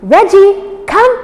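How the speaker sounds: high, loud call of the name; recall call. Shown as a demonstration. Trainer calling the puppy back, high and loud. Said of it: "try that one more time".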